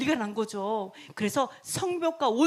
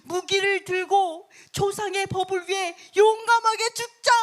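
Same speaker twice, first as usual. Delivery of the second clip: high, louder battle call; bold rallying cry; 7 semitones higher, 5 dB louder than usual. Acted. Bold citizen rallying others to die for the law, crying out. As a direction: rouse the crowd to fight. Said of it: "어떤 담대한 시민은 뭐라고 얘기하냐면".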